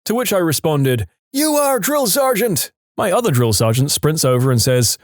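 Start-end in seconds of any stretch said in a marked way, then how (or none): 1.3-2.7 high, slow eager reply; recruit shout. Retold or imitated. Recruit sounding off to the drill sergeant, high and drawn out.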